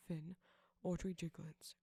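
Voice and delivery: usual speaking voice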